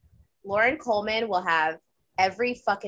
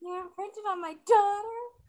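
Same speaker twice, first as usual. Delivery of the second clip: high, slow keening wail; keen it like a grieving banshee; 12 semitones higher, slower than usual. Pretend. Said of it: "the Banshee yell that I would do".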